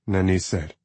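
ordinary delivery, nothing marked

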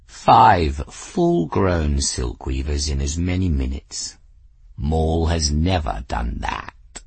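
low, self-satisfied purr of a boast; smug cat. Acted. Cat bragging about his kills, low and smug. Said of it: "he said smugly".

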